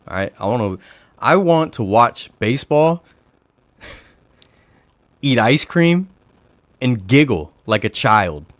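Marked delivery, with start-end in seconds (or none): 1.2-8.5 slow, loud, higher-pitched insistence; playful declaration. Real, not acted. Playfully declaring what he wants instead.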